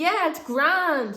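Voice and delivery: loud, high, drawn-out imitated shout; called-out reassurance. Man assuring driver from back seat, stretched loud call.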